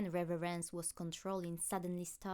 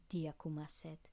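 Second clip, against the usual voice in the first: usual speaking voice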